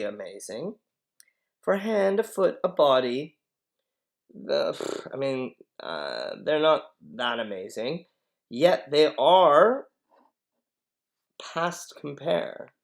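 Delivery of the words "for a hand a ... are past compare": teasing nurse. Acted. Nurse praising Romeo, drawn out.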